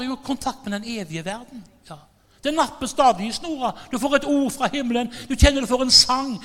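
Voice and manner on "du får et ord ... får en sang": ordinary talk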